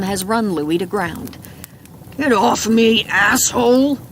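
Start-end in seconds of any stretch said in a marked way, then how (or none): 2.2-4.0 loud angry struggle; angry snarl. A narrator's reading. Pinned suspect cursing at the man holding him down, angry snarl. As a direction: shove back at the man pinning you.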